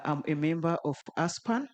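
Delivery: ordinary talk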